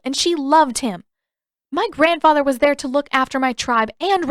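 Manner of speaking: firm, upset Katara. A narrator's reading. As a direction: lay down the truth about her grandfather.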